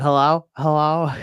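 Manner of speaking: slow, mechanical hello hello; mock robocall greeting. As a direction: mimic a robot caller. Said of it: "They're like".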